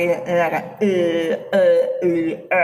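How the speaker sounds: drawn-out "ए" with tongue pushed forward; wrong vowel demonstration. Shown as a demonstration. Teacher shows students how not to say it, wrong "ए". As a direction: hold a misplaced, toneless "ए" as a bad example. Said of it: "इस तरह से नहीं बोल रहे हैं".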